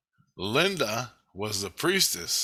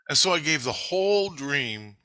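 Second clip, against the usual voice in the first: ordinary talk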